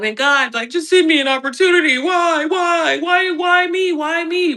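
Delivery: high, pleading whine to God; whiny plea to God. Retold or imitated. Imitating her old self whining to God, high and pleading. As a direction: mock-desperate begging, voice climbing high. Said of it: "I was somebody who was like".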